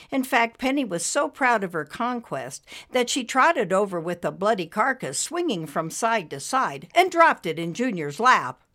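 ordinary delivery

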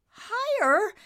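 incredulous echo. Acted. Nimbus echoing his father, incredulous. Son echoes in disbelief.

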